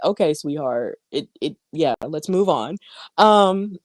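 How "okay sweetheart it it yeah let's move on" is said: 1 placating commentator. Retold or imitated. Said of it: "David doing all that was just kinda like".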